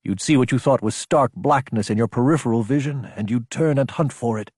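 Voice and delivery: ordinary delivery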